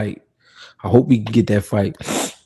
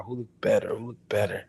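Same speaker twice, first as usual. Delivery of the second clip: whisper; 12 dB quieter than usual. Shown as a demonstration. Host repeats the chat question to himself in a whisper.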